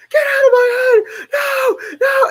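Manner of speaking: high, loud full-voiced scream; piercing high-pitched screams. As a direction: scream it out as if in torment. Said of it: "she just heard me screaming".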